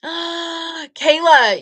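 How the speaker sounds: stunned realization; astonished gasp. Real, not acted. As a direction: gasp her name in shock. Realizing Kayla painted them.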